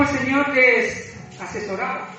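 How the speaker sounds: normal talking voice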